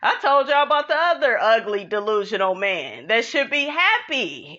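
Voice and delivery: usual speaking voice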